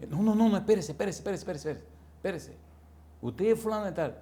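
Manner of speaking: high, rushed woman's voice, pleading him to stop; startled protest. Retold or imitated. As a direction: rush to hold him on the line, voice jumping up.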